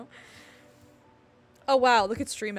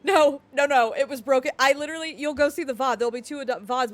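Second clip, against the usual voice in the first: 1 loud protest; 4 semitones higher, 11 dB louder than usual. Real, not acted. Defending herself to chat.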